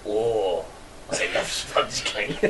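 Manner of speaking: low, soft, slow murmur; sample wording. Shown as a demonstration. Giving friend the wording to say, low soft murmur. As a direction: murmur it low with an unvoiced tail. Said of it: "an Eastern German accent".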